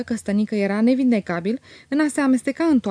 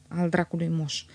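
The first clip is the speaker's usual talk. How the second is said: low, soft grumble to himself; understated murmured curse; muttering; 3 semitones lower, 6 dB quieter than usual. A narrator's reading. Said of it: "murmură el".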